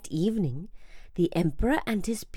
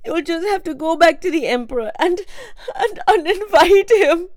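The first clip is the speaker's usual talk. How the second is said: tearful; 12 semitones higher, 6 dB louder, faster than usual. A narrator's reading. Mother gives up cooking, tearful. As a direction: say it on the verge of tears.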